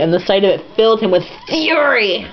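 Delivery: ordinary talk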